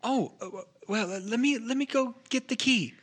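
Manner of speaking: high-pitched, stammering realisation; flustered Willow. Acted. Game master as Willow realises mistake and fetches key, flustered.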